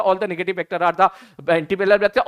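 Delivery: rattled off as one item in a quick list; deliberately fast. Shown as a demonstration. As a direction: hurry through it with little emphasis. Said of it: "मैं जानबूझ के fast बोल रहा हूं". (जानबूझकर जल्दी-जल्दी, सपाट गिनाते हुए)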